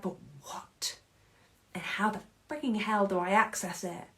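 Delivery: a voiceless, fed-up murmur; hushed frustrated question. Retold or imitated. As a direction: whisper it, breathless and exasperated. Frustrated seeker quoted as "we're like.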